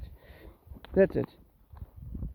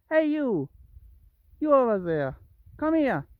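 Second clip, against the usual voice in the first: higher, louder summons with falling ends; calling out; 6 semitones higher, 4 dB louder than usual. Real, not acted. Owner calling the straying cat back, loud summons. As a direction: call across to someone straying.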